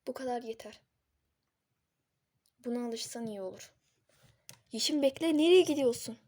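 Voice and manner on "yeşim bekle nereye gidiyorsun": loud desperate shout; shouting in panic; calling out. Acted. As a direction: yell after her as she walks away. Kerem calls after leaving Yeşim.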